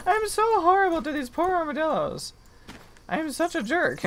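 high, squeaky mock-guilty wail. Gamer to the armadillo, a high mock-guilty squeak. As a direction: whine it out like a guilty little kid. Apologizing to the armadillos she kills.

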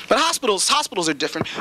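ordinary talk

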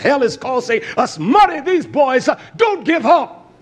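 loud, high-pitched fiery sermon delivery; full-force preaching. Acted. Preacher denouncing leaders over bombed nations, full-force preaching. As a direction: thunder it out like an indignant preacher.

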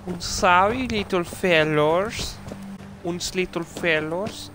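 hammed-up German accent. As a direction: drag out little fellas in a jokey German accent. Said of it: "I always lose my German accent".

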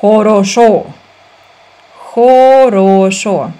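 normal talking voice

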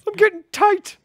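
high mocking imitation; mock whiny voice. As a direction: squeak it out to tease. Mocking friend's reaction.

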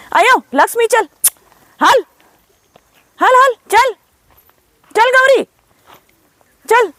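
short, very high-pitched calls; herding calls. Real, not acted. Woman walking Lakshmi and Gauri round, calling out to them. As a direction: urge the herd on in high bursts. Herding her cows along.

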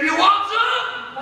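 slow, high mocking challenge; tough taunt. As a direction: taunt them like a brawler. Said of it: "He's gonna say".

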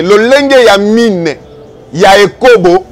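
usual speaking voice

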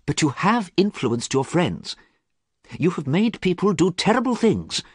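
high, earnest accusation; a pained friend demanding answers; reproachful friend. A narrator's reading. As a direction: press him hard. Basil reproaches Dorian.

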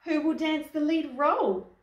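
high, loud cry of alarm; acted, storybook outcry; cried out. A narrator's reading. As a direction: call out like a frantic director. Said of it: "cried the ballet director".

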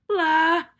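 loud exasperated blah; hammed-up groan blah; frustrated blah. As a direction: blurt out in frustration. Toad's frustrated exclamation.

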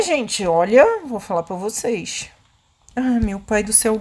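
normal talking voice

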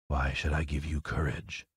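low, quiet wizard voice; demanding Oz. Acted. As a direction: question the petitioner in a low, soft voice. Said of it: "demanded Oz".

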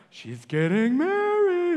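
high, slow swooning news voice; gushing TV voice. Retold or imitated. Preacher mocking TV hype: high, drawn-out gushing. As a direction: coo it like breathless TV coverage. Mocking TV coverage of royal wedding.

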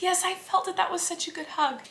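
tender reply. Real, not acted. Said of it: "I said".

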